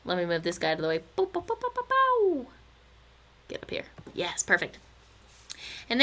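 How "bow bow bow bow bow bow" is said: singsong cartoon popping noises, rising; bouncy little falsetto noises; playful moving noise. Imitated noise while moving a drawing.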